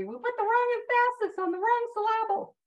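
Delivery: high-pitched movie quote, stressing emPHASis and sylLABle wrongly; put-on high voice, "emphasis" as em-FASS-is, "syllable" as sil-LAB-le; misplaced word stress. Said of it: "it's quoting that movie".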